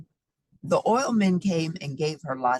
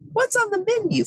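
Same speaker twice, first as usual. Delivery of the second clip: high cowboy voice; 4 dB louder than usual. Acted. Rancher asks diner owner.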